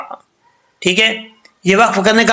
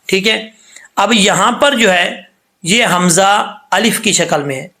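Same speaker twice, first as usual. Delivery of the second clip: ordinary talk, nothing marked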